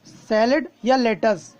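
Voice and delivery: Salad या latus said slowly, word by word; drawn out slowly so students catch each word; slow clear repeat. Shown as a demonstration. Teacher repeating salad या latus slowly for learners. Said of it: "salad बोलते हैं और latus भी बोलते हैं".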